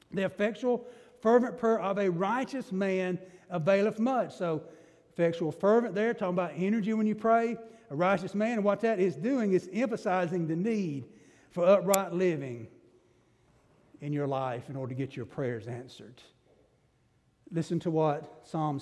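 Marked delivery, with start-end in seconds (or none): none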